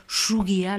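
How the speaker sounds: "Chougia" opening on an sh-like Basque s; hushing S. Shown as a demonstration. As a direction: let the first sound of "Chougia" hush like sh. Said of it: "Le S est chuente". (« Chougia » : s chuinté au début)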